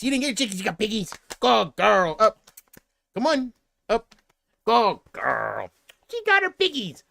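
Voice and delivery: high, excited praise for the pup; high gushing dog talk; high doggy praise. Real, not acted. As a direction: gush over the dog's toy. Praising dog with her toy.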